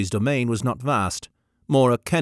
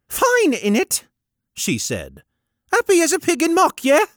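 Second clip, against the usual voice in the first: put-on high, breezy Cockney-ish girl; cheeky young woman voice; 6 dB louder than usual. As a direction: toss it off cheekily. Gallowglass casual slang.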